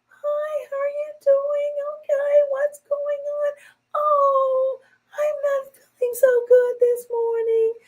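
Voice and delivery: mock a sugary whiny woman; fake sweet voice. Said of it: "women that talk like that".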